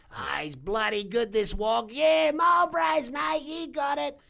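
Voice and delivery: high, loud caricature of an Aussie punter; brash Aussie customer. Acted. Pub regulars ordering cigarettes at dad's milk bar, brash mocking caricature. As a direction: play a rowdy regular hollering over the counter.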